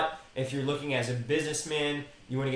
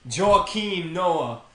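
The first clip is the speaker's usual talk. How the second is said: loud, drawn-out name announcement; 5 semitones higher, 7 dB louder, much slower than usual. Real, not acted. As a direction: belt out the name slowly, pitched up. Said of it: "excuse me for being so loud".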